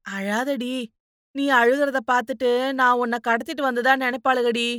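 insistent maternal comforting; motherly consoling. Acted. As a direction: urge a crying child to stop.

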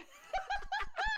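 squealing burst of laughter; high laughing fit. Real, not acted. Best friend tier list memory.

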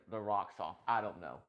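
normal talking voice

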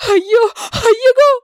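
high, loud grieving cry; pitiful wail. Acted. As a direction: wail as if your heart breaks. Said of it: "பரிதாப குரல்கள் ... சோகத் தொனியில்".

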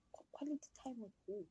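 breathless stunned whisper; shocked whisper. Retold or imitated. Whispering to the older man in shock, stunned whisper. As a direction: whisper it in disbelief. Shocked reply to man's offer.

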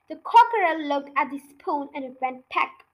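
ordinary delivery

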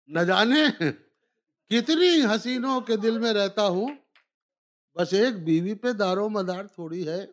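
rising, declaimed couplet; poetry recitation. Acted. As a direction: declaim it to a live audience. Repeats couplet for audience.

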